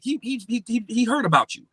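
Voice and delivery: high, stammering retold amazement; incredulous. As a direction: trip over the first word in acted disbelief. Reliving astonishment at being recommended.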